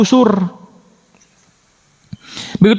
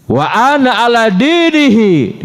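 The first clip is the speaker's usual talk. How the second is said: Hamzah's blurted oath; 7 semitones higher, slower than usual. Retold or imitated. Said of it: "keceplosan ngomong begitu".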